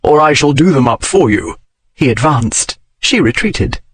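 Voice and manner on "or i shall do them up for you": stern. A narrator's reading. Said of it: "he said so very sternly".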